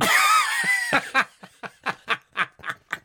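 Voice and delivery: shrieking high laughter; real squealing laughter; long high laughing fit. Real, not acted. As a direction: crack up and keep going. Cracking up after the punchline.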